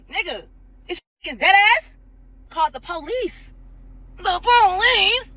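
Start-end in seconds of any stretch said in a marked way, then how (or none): 2.5-5.3 high, slow, drawn-out incredulous question; mock disbelief. Real, not acted. [name] mocks Dennis for calling the police, in slow high disbelief. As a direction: stretch out the police in mocking disbelief.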